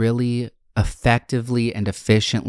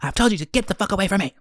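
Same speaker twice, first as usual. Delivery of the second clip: high, frantic and fast; imitating a frantic woman; 12 semitones higher, faster than usual. Imitating a woman in a dressing room yelling at nobody, high and frantic. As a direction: shriek it at an invisible attacker. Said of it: "she was like".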